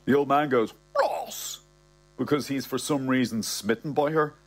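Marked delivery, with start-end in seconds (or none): 1.0-1.6 put-on a sharply raised, high posh old gentleman's bark of a name; pompous old man. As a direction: snap the name out high like an affronted old gent. Old man scolds Bernie's critic.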